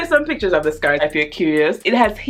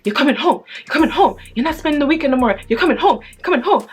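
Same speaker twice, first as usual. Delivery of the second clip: mock angry mom; 8 semitones higher, faster than usual. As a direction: bark it out like a fed-up mother. Mom angrily ordering me home.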